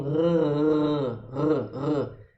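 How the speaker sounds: long rolling "r" up by the roof of the mouth; trilled French R. Shown as a demonstration. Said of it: "ela faz um R mais aqui em cima".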